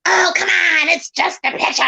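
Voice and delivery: lightly voiced nagging hag; a hint of a shrill old hag. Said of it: "I hear a hag voice say".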